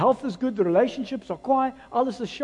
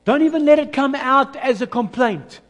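"Don't even let it come out as a complaint" loud and higher; loud repeat; 6 semitones higher, 6 dB louder than usual. Real, not acted. Pastor repeats the warning to the congregation, loud and emphatic. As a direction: hammer the warning home. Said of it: "repeated louder".

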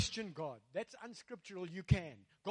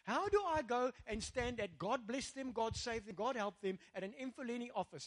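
usual speaking voice

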